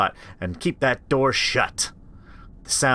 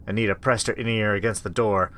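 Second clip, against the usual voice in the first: ordinary talk, nothing marked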